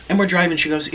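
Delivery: normal talking voice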